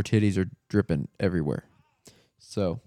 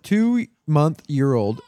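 slow teasing; 5 semitones higher, 5 dB louder, much slower than usual. Pretend. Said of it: "deliberately repeating the wrong phrase".

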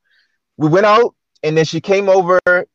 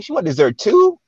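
higher, incredulous retold reaction; mock disbelief; 7 semitones higher than usual. Diner reacting to his date's extra order, mock disbelief. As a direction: recount it in rising disbelief.

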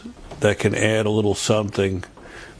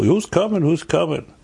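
higher-pitched, lightly acted curiosity; eager asking around; 7 semitones higher than usual. Congregants asking each other on the way home from shul, lightly acted eagerness. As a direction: ask it high, like gossip passed around. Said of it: "Everybody asks each other".

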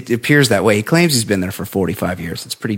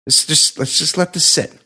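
usual speaking voice

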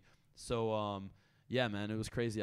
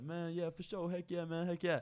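lightly voiced upbeat rapper, pitched up; 5 semitones higher than usual. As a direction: imitate a super positive guy, higher voice. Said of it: "He's just such a positive guy".

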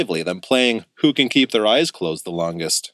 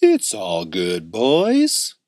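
assuring; much slower than usual. A narrator's reading. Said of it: "assured Uncle Louie".

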